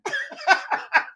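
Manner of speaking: laughing hard at a viewer's story; high burst of laughter. Real, not acted. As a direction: break into helpless laughter.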